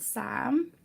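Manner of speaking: usual speaking voice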